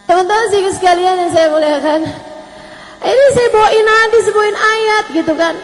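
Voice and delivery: sarcastic sermon to a husband at home; mock preaching. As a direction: lecture him like a congregation from the stage. Mocks preaching at her husband.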